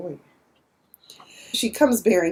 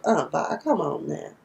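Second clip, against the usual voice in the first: teasing coax; 5 semitones lower, 6 dB quieter than usual. Retold or imitated. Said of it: "I was like".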